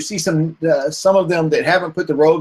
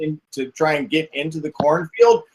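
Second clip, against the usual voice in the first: ordinary talk, nothing marked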